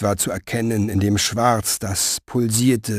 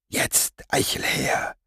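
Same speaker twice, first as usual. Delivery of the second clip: an urgent whisper; 9 dB quieter than usual. Acted. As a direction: breathe it, no voice at all. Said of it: "flüsterten sie". (geflüstert, eindringlich)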